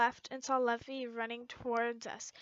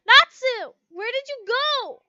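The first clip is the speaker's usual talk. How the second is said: loud call across the hall, higher; 9 semitones higher, 11 dB louder than usual. Acted. Narrator calls out to missing Natsu loudly. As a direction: shout his name to find him.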